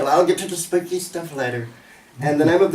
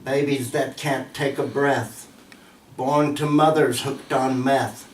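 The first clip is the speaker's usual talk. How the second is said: measured poetry reading, pausing after each line; poem recitation; 3 semitones lower, slower than usual. Acted. Recites own poem on suffering children.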